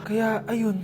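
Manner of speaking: soft, low doctor's voice; gentle sad explanation. Acted. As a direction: break it to her quietly. Doctor gently reminds patient of deaths. (mahina at mababang pagpapaliwanag)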